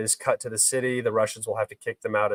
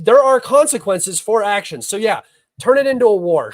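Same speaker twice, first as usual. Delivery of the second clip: heated; 8 semitones higher, 8 dB louder than usual. Real, not acted. Heated rant at chat.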